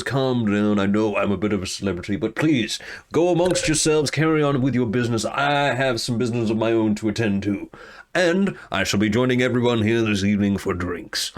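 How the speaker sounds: a hint of the showman; lightly voiced swaggering hero. Alador addresses the guild hall, lightly voiced and grand. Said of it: "he goes".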